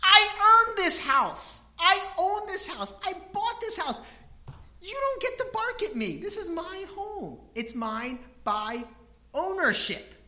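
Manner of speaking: indignant homeowner. Retold or imitated. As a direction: make the dog know who owns the house. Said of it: "I think".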